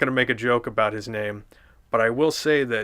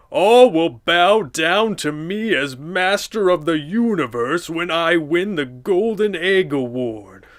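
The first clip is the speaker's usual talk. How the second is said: Plankton; 5 semitones higher, 5 dB louder than usual. Acted. Plankton's villain speech.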